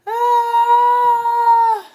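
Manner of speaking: long high loud wail; laughing wail. Real, not acted. Overcome by the joke, long high wail. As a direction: let out a sustained high cry.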